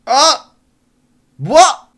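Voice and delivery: a sudden high yelp of shock, loud; startled shriek. Real, not acted. Gamer jolted by a sudden in-game death, shrieking in shock. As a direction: caught off guard, shout it out.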